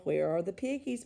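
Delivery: plain reading voice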